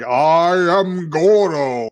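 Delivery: slow, drawn-out possessed proclamation; mock-grand spirit voice, very slow; Goro. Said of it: "he's channeling the spirit of their father".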